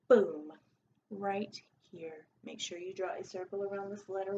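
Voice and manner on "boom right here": usual speaking voice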